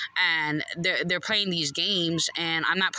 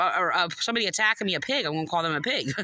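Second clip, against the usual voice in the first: usual speaking voice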